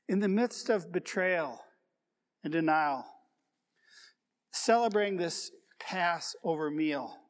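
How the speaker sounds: ordinary talk, nothing marked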